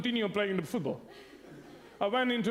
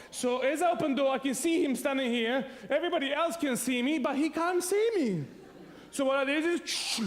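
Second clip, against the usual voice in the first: usual speaking voice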